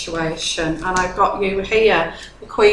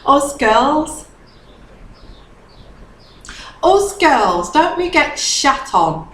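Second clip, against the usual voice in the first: emphatic; 5 semitones higher, slower than usual. Real, not acted. Sisterly rally cry.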